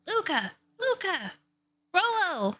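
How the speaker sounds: high urgent calls; calling out names. Acted. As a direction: call across the woods.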